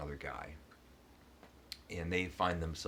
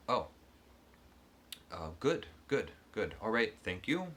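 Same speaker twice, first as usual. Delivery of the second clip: forced cheer; 5 semitones higher, 4 dB louder than usual. Retold or imitated. Hero hides dread from seconds.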